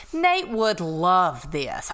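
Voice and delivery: slow, stretched realization; drawn-out musing. Retold or imitated. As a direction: linger on each word as the thought lands. Repeated nagging thought, first take drawn out.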